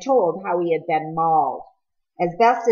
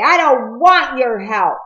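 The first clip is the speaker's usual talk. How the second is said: loud, higher, hurt rejection; angry refusal; 6 semitones higher, 6 dB louder than usual. A narrator's reading. Peter turning away from Cole, loud angry refusal. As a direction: push the help away, loud. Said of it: "turning away".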